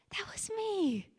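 hushed, breathy whisper; barely voiced, tender recognition. Real, not acted. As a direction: whisper it to yourself. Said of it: "my voice still cracks".

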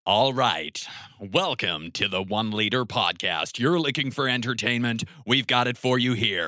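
American news anchor voice; cheesy American. Pretend. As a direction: sell it like a cheesy American presenter. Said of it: "cheesy American".

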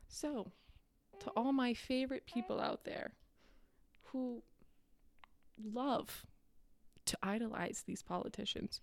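soft, half-whispered confiding to the crowd; quiet conspiratorial aside; hushed aside. Real, not acted. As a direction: lean in and drop the voice.

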